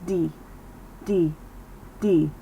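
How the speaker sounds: "D" with barely any air through the teeth; airless D sound. Shown as a demonstration. Teacher shows the difference in air, soft D. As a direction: keep the breath back on each "D". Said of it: "I'm barely moving any air".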